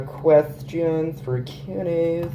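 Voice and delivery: slow, stretched-out search phrase; drawn-out words. Real, not acted. Looking up questions for Q and A's, slowly drawn out. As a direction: say it slowly as if typing it. Says the search while typing it.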